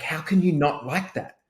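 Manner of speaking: high baffled question; can't believe it; incredulous question. Retold or imitated. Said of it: "And I'm like".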